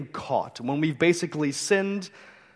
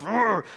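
a short hammed-up frustrated roar; mock angry growl; 7 semitones higher, 3 dB louder than usual. Pastor acting out anger in an example, mock frustrated growl. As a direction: growl it like your blood is up.

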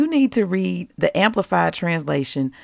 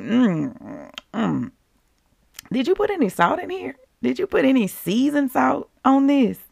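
mock disgusted taster; 4 semitones higher, 4 dB quieter than usual. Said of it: "You were like".

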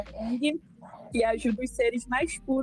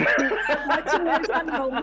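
slow, laughing, higher; laughing slogan; 4 semitones higher, slower than usual. Acted. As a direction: drag it out through laughter.

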